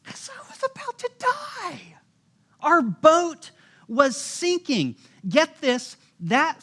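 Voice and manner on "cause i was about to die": much higher, exasperated squeak of disbelief. Retold or imitated. Preacher answering why he was afraid, exasperated and high. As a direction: answer like it's painfully obvious. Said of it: "I'm like".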